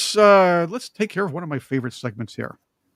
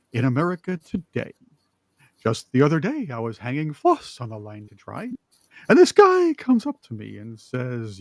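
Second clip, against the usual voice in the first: Emo Phillips impression; 3 semitones higher, 4 dB louder than usual. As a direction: play the dithering oddball comedian, drawing out each line. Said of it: "I'm gonna do it in his voice".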